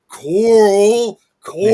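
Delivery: loud, high, drawn-out mock wail of "Coral"; parody clip yell. Host quoting Walking Dead parody clips, loud parody yell. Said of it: "parody clips of Walking Dead when they're like".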